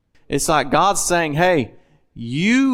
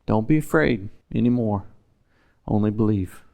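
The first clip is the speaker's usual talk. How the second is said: reassuring; 3 semitones lower than usual. Retold or imitated. Jesus voiced reassuring Jairus.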